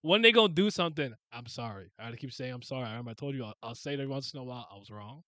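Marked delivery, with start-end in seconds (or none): none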